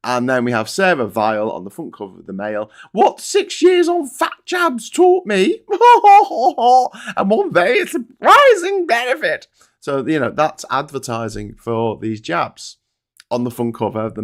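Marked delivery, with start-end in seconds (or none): 2.9-9.5 high, loud sneering headline read; hammed-up posh mockery; mocking prissy voice. Mocking Daily Mail headline.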